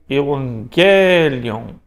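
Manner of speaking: "Evangelion" repeated slowly, gamma as N; slow Greek pronunciation. Shown as a demonstration. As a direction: model "Evangelion" for students, unhurried. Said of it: "Pronúncia do gama?".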